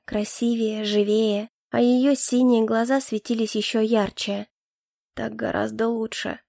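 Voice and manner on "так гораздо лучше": softer, drawn-out husky line; a lover's husky murmur, softer; hoarse drawl. A narrator's reading. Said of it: "хрипло протянул Кай".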